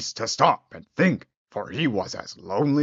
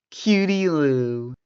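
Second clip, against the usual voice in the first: put-on tender old man; 5 semitones higher, slower than usual. Old man addresses girl.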